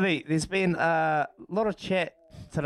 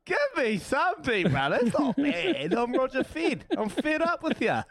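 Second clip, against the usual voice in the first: laughing; 9 semitones higher than usual. Real, not acted. Laughing, high pitched banter.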